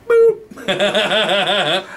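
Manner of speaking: high, loud "boop"; comic high-pitched phone beep; imitated hang-up beep. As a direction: sing out a button-press noise. Mimes hanging up with a noise.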